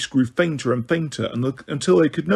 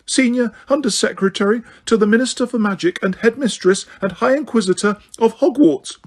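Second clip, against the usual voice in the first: high-pitched, terrified; 8 semitones higher than usual. A narrator's reading. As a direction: pitch it up in fear. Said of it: "in a high-pitched, terrified voice".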